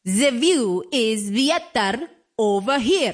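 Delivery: put-on drawn-out Russian accent of a stranger close behind. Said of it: "This Russian or something accent behind me".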